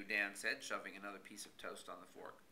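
plain reading voice

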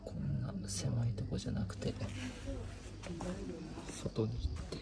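soft whispered farewell; tender whisper. Real, not acted. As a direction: gently send the bird off in a whisper. Gently sending the pigeon off.

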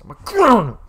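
high, loud pretend outrage; exaggerated fake scolding; mock angry parent. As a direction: yell it as a hammed-up furious parent. Mock-angry parent threatening son.

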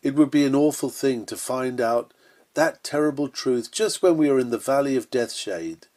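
ordinary delivery

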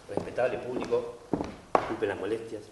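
lightly voiced actor addressing the house; stage apology.

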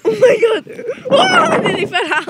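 high, loud panicked cry; real shock at nearly falling; panicked yelp. Real, not acted. Nearly falls off the swing.

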